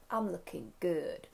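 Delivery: soft, slow, impressed murmur to oneself; savouring a compliment to yourself, hushed; quiet self-admiration. Acted. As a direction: admire yourself slowly and softly. Acted admiring look in mirror.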